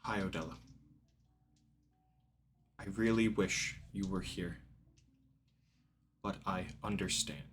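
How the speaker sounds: a hint of a gentle, hushed father; tenderly, softly, like a father missing his daughter. DM voices the father's reply.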